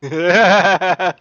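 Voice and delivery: loud, high burst of laughter; real belly laugh. Real, not acted. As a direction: laugh out loud, hard and short. Laughs before mentioning wife's story.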